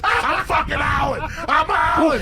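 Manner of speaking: very high, loud comic yell; hysterical shouting. Retold or imitated. Comic retelling his reaction to the kid's story, in a hysterical shout. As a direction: shriek it as if overcome by the joke.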